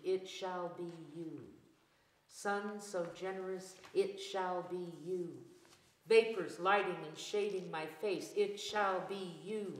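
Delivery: ordinary talk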